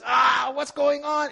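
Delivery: high, slow, stretched-out wail; mock panic. Preacher shows getting in that place, mock panic. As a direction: act out overwhelmed exasperation.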